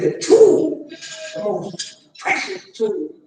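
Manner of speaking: "is influence" stretched very slowly, words held apart; slow solemn reveal; slow dramatic quote. Retold or imitated. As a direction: let "influence" fall heavy and slow. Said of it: "He said".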